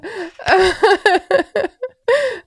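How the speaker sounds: high squealing laugh; high laughing fit. Real, not acted. Host laughing at fans greeting her with squealing laugh. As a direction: laugh until it nearly cries.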